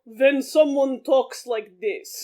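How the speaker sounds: hammed-up film-Russian accent; movie Russian accent. Shown as a demonstration. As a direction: draw out each word in mock Russian. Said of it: "can't even do this accent".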